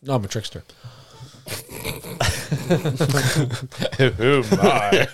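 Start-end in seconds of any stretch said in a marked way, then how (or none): 1.5-5.1 wheezy laughing. Real, not acted. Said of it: "I'm wheezing over here".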